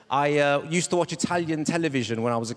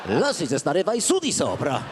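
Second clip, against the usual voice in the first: swooping Italian-dub cartoon mum; Marge dubbed in Italian; 3 semitones lower than usual. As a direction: scold in a melodramatic Italian dub voice. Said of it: "You had Marge in Italy".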